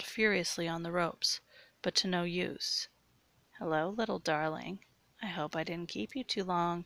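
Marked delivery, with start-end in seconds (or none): none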